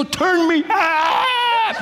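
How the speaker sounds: very high, slow, drawn-out plea; mock demand to the sound man. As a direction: stretch it out like a diva singer. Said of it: "go up to the sound man and go".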